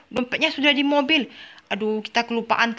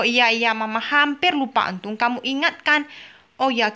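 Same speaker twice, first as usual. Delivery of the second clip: ordinary talk, nothing marked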